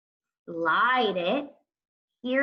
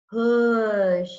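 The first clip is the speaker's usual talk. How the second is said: slow sounding-out; 2 semitones higher, 5 dB louder than usual. Shown as a demonstration. Teacher sounding out a word for young writers, slow and stretched. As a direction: push drawn out long and loud, the vowel held. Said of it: "Let me say the word slowly again".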